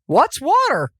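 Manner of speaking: little fish who has never heard the word; young fish confused by the question; puzzled little fish. Retold or imitated. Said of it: "the little fish look at the older fish and go".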